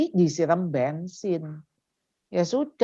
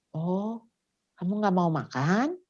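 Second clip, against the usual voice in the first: soft, understanding check-in; acted patient mother, voice lowered; gentle parent; 7 dB quieter than usual. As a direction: quietly reflect back to the child. Models calm reply to child.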